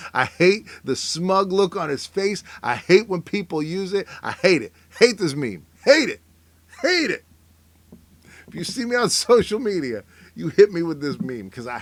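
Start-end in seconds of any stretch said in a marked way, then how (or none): 0.1-7.2 loud, emphatic loathing, climbing to a high yelp; building hateful rant. Real, not acted. Ranting about a hated Gene Wilder meme, comically escalating anger. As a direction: hammer each repeat harder and higher.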